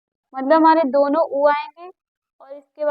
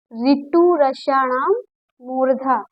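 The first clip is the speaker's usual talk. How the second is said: ऋतु रसाणाम मूर्धा drawn out slowly; slow recitation; slower than usual. Shown as a demonstration. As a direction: recite slowly so the class can follow. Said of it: "आप जहाँ बैठे उच्चारण करते जाइए". (धीमा सूत्र-पाठ)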